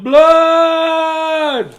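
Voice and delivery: very high, loud drawn-out cry; yelled. Acted. Loretta to the police about her brother, very high loud yell. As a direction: call it out long to get the police to help.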